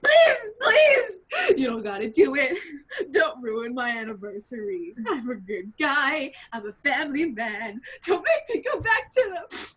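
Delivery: tearful begging. Acted. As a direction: beg desperately through hammed-up tears. Acted pleading for his life.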